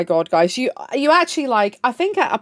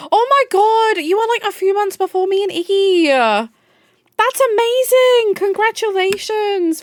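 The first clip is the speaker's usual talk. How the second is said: excited; 9 semitones higher than usual. Real, not acted. Thrilled at friend's news.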